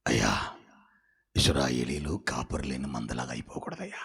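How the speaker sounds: low, breathy whispered plea to God; hushed prayer. Retold or imitated. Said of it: "అని ప్రార్థన చేస్తున్నాడు".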